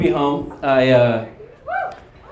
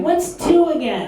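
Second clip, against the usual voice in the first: slow, high dopey question; dumbest kid in class; 10 semitones higher than usual. Retold or imitated. Dumbest kid asking for answers, slow high mock voice. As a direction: imitate a clueless pupil, drawn out and high. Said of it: "the dumbest kid in the class".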